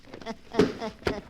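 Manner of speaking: short eerie chuckle; a little gleeful laugh over the dark tales; sinister chuckle. Acted. Keeper of the book relishing murder tales, eerie chuckle.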